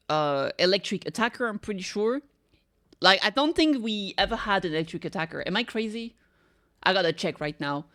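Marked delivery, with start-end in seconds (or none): none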